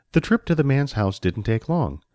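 usual speaking voice

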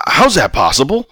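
high, loud, shocked question. A narrator's reading. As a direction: blurt it out in disbelief. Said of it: "Rob asked, shocked".

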